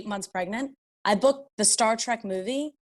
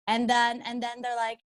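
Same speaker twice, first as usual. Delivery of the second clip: normal talking voice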